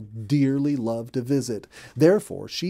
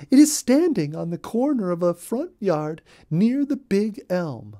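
chatty rabbit, much higher, rattling on with gossip; a busy little rabbit spilling all the news; Molly Cottontail; 8 semitones higher, 5 dB louder than usual. Acted. Molly voiced in read-aloud story.

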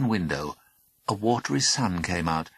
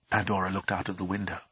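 plain reading voice

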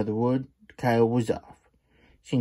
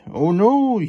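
alarmed cry; 11 semitones higher, 7 dB louder than usual. Acted. Flood rushing at Slim.

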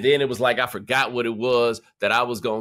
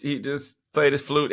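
ordinary talk, nothing marked

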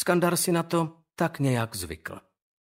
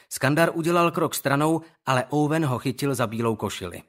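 usual speaking voice

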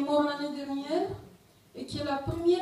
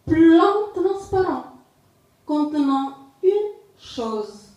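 deliberate recital in short, separated phrases; poetry reading; 5 dB louder than usual. Acted. Poet reading a poem on exile to listeners, poetry reading. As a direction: declaim the poem, pausing after each phrase. Reads own poem aloud.

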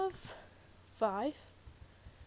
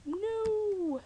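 high wailing no; retold dismay; 11 semitones higher than usual. Gamer whose recording didn't save, wailing. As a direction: wail no in dismay. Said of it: "I was like".

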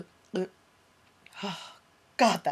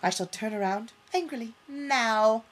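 normal talking voice

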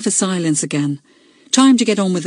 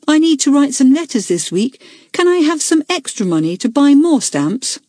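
put-on higher, eager young boy asking a favour; pleading boy; 8 semitones higher than usual. A narrator's reading. Peter asking the school meeting for stamp money, higher pleading schoolboy voice. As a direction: ask nicely, like a schoolboy wanting extra money. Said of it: "said Peter".

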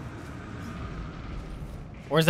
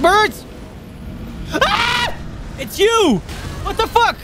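much higher, loud frantic yells; panicked shouting; 4 dB louder than usual. Real, not acted. Player ambushed by birds, screaming in panic.

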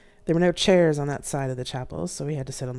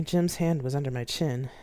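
ordinary delivery

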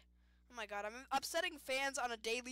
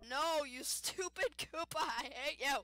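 louder, slow indignant shout; mock-angry yell; 4 semitones higher, 7 dB louder, slower than usual. Real, not acted. Racer yelling at the Koopa cheating in the race. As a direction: yell at the rival in playful anger. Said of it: "I'm getting mad".

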